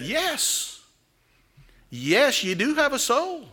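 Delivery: a hint of the parson gently insisting. Parson to the village boy, a touch of insistence.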